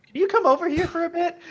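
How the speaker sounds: high, loud, fast come-hither line; seductive call. Acted. Captive character calling the players over, playfully seductive.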